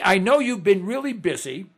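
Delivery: ordinary talk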